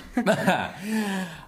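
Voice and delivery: laughing at her own cheeky question; a quick delighted laugh, pitched high; real amused laugh; bright burst of laughter. Real, not acted.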